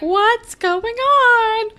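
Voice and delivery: high, loud, drawn-out question; mock bewilderment. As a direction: imitate a stunned date, stretching the words. Voicing stunned date at limo.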